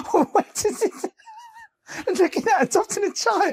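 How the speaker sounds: squealing high disbelief through laughter; can't believe what she's hearing; stunned high-pitched laughing question; incredulous laughing. Real, not acted. Disbelief at the story.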